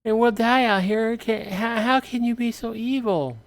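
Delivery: high shrill-pitched accusing plea; woman outside accusing them of evil; desperate woman. Acted. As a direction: cry out at their cruelty. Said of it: "the woman says".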